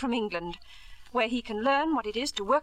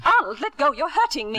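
louder, higher, alarmed; pained protest; 4 semitones higher, 5 dB louder than usual. Acted. Wife seized by her husband, pained alarmed protest. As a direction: pull away and cry out.

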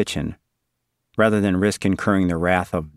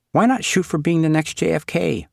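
higher-pitched, lightly voiced nun's suggestion; Sister Val's encouragement; 9 semitones higher than usual. A narrator's reading. Sister urging the boy toward politics, higher encouraging voice. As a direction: lift it up, encouraging a child.

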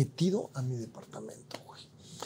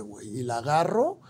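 usual speaking voice

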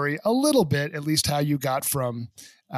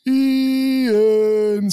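loud, higher drawn-out call of the name; room-greeting name call; 7 semitones higher, 6 dB louder than usual. Retold or imitated. High school crowd hailing Ian entering a room, loud drawn-out call. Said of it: "every time he walked into a room, it was".

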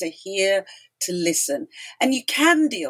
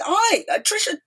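excited greeting; 7 semitones higher than usual. Retold or imitated. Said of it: "and he said".

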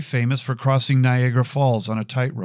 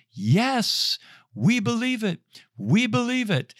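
mock crowd yell; 11 semitones higher than usual. Crowd answers the man on the rope, mock yell. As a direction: imitate an eager crowd hollering back in a high voice. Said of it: "they start to yell".

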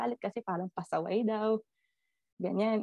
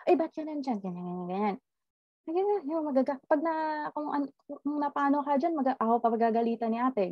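a higher, quick retold scolding; mock scolding godmother; 6 semitones higher than usual. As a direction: fret at the child sitting on the window. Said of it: "pinagalitan ng ninang ko".

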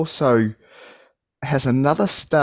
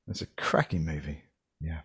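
soft unvoiced whisper of praise; 8 semitones lower, 11 dB quieter than usual. Real, not acted. Host quietly recommending the film to listeners. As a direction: say it hushed, like a secret tip.

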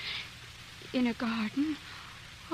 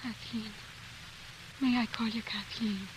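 trembling with feeling; 5 semitones lower, 9 dB quieter than usual. Shown as a demonstration. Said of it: "in a tone which trembled with the force of his feelings".

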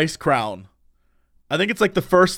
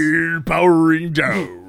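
robot boss voice; slower than usual. Retold or imitated. Friend mocks a bad game voice line, droning robot. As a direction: drone it like a powering-down robot. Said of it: "that guy who's like".

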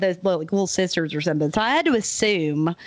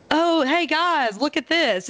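higher and very slow, breezily unbothered; nonchalant discovery; 7 semitones higher, slower than usual. Retold or imitated. Guy shows friends the amulet, casually unbothered. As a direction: announce a gruesome find like a fun surprise. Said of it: "he doesn't freak out at all".

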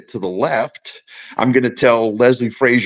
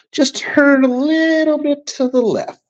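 mock advice to Norwood; slower than usual. Time traveller advising kicker Norwood.

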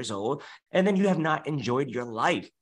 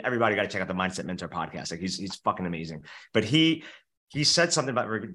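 ordinary delivery

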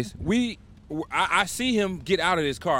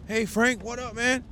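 imitating ex-cohost; 8 semitones higher than usual. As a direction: play the fake-friendly guy calling out across the store. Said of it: "He come".

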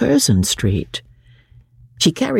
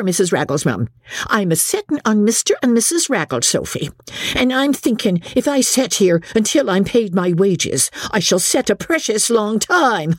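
higher-pitched servant's dialect voice; defiant cook; 8 semitones higher than usual. A narrator's reading. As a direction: dig in stubbornly, dropping the g's.

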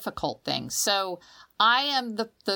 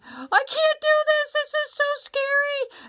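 put-on very high, wailing little-kid panic; terrified child; 4 dB louder than usual. Kid at the end of the high dive, high panicked wail. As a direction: cry it out from the edge of the board. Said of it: "I'm standing on the end saying".

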